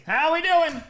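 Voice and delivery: high, loud shout-out to viewers; hyped greeting. Real, not acted. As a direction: call out to the crowd. Greeting the stream at the opening.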